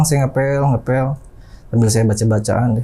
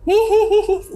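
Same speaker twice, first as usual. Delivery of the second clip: very high, giggling ghost laugh; kuntilanak cackle; much slower than usual. Retold or imitated. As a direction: imitate a shrieky female ghost's tittering. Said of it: "sambil ketawa". (tawa ngikik kuntilanak, melengking tinggi)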